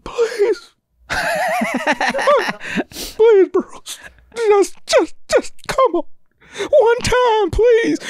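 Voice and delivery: very high, hammed-up sobbing plea; imitating crying fans. Grown fans begging the star, tearful pleading. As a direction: whimper and beg in a squeaky mock cry. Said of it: "they're crying, bro".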